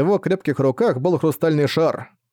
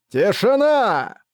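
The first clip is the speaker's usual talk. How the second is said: long drawn-out, much higher roaring shout; guttural rough orc roar; 7 semitones higher, much slower than usual. A narrator's reading. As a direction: stretch out a huge battlefield roar. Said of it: "Мощный крик пронзил". (протяжный громовой рёв орка)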